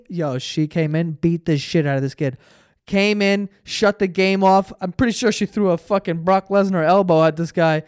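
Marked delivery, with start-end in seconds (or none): none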